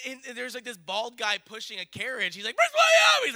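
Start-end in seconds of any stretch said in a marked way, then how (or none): 2.5-3.3 shrieked name, far higher and louder; freaking-out shout. Retold or imitated. Man pushing a carriage spots a royal, frantic shout. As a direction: yell it out in frantic excitement. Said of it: "He's, like, freaking out".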